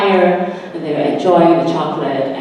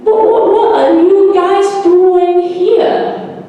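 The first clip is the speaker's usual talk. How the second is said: much higher, louder, slow sung greeting; slow singsong girl voice; 14 semitones higher, 7 dB louder, much slower than usual. Acted. Forest girl calling to the strangers, singsong. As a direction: draw it out like a little song.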